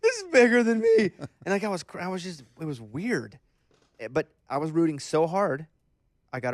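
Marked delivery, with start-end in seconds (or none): 0.0-1.1 loud, high tearful wail; mock crying. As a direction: blubber it like an overwhelmed fan, hammed up. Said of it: "I started crying".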